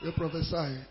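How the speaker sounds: ordinary delivery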